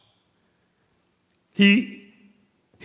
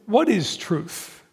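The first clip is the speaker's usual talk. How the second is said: slow, dismissive sneer at the question; slow sarcastic scoff, a shade softer; sarcastic Pilate; 4 dB quieter, much slower than usual. Acted. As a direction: let the words drip with scorn as he turns away. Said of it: "You can hear the sarcasm in his voice".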